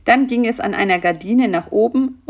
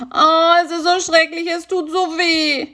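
moaning; 10 semitones higher, 4 dB louder than usual. A narrator's reading. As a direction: moan it like a toothache. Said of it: "stöhnte Karl-Heinz".

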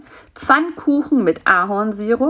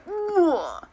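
high, falling noise of revulsion; disgusted groan; 11 semitones higher, 4 dB quieter than usual. Acted. Fly is offered crumbled ladybirds, disgusted groan. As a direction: let out a high retching groan.

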